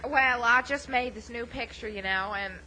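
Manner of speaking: put-on Southern accent. Film star explaining her new picture, Southern accent. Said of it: "put on a Southern accent".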